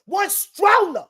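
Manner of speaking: angry shout. Real, not acted. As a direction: bellow it out in outrage. Ranting about stolen items.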